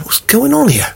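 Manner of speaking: mock bewilderment at the sudden kindness; puzzled thought. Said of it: "And I thought".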